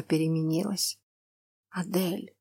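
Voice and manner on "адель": soft, tender naming; warm tender. Acted. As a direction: say her name with longing. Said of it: "Столько тепла было в этом слове".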